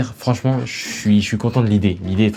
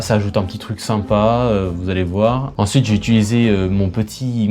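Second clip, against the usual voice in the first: usual speaking voice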